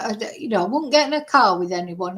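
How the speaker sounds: ordinary talk, nothing marked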